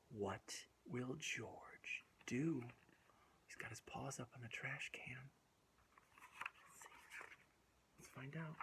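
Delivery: put-on whispered cliffhanger to young listeners; suspense whisper. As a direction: draw the kids in with a secret. Suspense moment in story.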